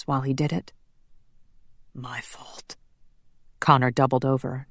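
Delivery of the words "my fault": barely audible broken whisper; guilty whisper. Acted. Connor doubled over with guilt, a faint whisper. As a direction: breathe the words out to yourself.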